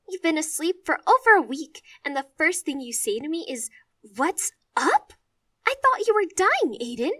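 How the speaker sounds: high, loud upset outburst; tearful scolding. Acted. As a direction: scold him through tears.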